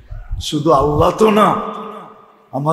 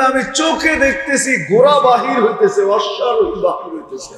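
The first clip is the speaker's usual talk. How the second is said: raised, fervent sermon climax; impassioned preaching; 6 semitones higher than usual. Acted. Preacher describing the horsemen appearing to the crowd, fervent. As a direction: build the vision up with fire. Preacher at full force, shout.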